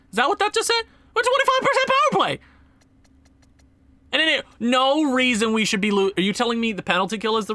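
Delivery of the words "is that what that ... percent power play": much higher, incredulous repeat of the number; stunned disbelief. Real, not acted. Gamer reading his team's stats aloud, stunned disbelief. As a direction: say it like you can't believe what you read.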